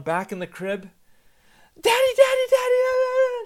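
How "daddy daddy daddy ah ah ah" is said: piercingly high, very loud mimicked wail; imitated toddler screaming. Toddler put back down in the crib to daddy, screaming. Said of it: "She starts screaming again".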